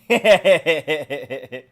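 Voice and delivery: big burst of laughter; cracking up out loud. Real, not acted. Laughs after the princess bit.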